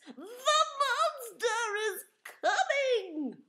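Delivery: high, loud, drawn-out slow warning; loud, slow frightened cry; panicked bellow. Acted. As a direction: stretch out the alarm slowly. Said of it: "bellowed Amos".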